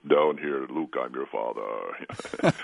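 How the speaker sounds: deep, slow Vader voice; low, slow movie villain breath; Darth Vader. Pretend. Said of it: "I sound like Darth Vader right now".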